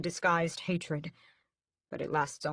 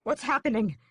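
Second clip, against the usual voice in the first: higher, louder alarmed gasp; 7 semitones higher, 5 dB louder than usual. A narrator's reading. Brother gasping as Raoul spits blood. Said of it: "I gasp".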